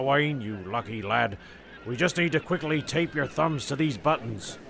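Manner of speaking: usual speaking voice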